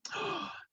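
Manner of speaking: quick high startled gasp; a sudden surprised intake of breath; sharp gasp. Real, not acted. Teacher gasps at the quiz answer, startled.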